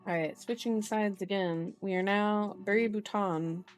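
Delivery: usual speaking voice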